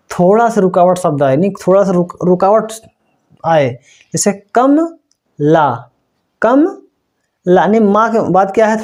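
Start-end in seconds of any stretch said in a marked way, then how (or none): 4.1-8.8 कम then a pause then ला; pause in Kamala. Shown as a demonstration. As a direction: break Kamala as कम ... ला with a short stop. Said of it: "थोड़ा सा हल्का सा हम रुक रहे हैं". (कम और ला के बीच हल्का सा रुककर)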